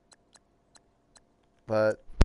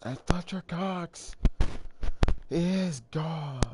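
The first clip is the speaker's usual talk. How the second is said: higher, hyped announcer call of the name; excited call; 7 semitones higher, slower than usual. Pretend. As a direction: call the big play like it's live. Calling a big play on replay.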